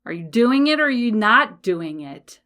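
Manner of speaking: loud, insistent challenge; challenging coach voice. Acted. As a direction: call them out loudly, demanding an answer. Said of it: "I want you to hear my voice".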